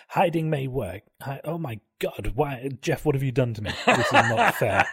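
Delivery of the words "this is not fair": high, slow laughing complaint; laughing whine. Real, not acted.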